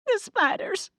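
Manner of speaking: high, frightened whimper. Acted. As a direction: squeak it out, terrified. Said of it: "Amelia whimpered".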